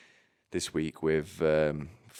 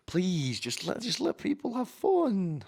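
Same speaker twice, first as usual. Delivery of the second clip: much higher, softer mocking plea; mock pleading woman; 12 semitones higher, 5 dB quieter than usual. Mocking a woman begging at a fight, high soft whiny plea. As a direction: whine it like a fussy onlooker.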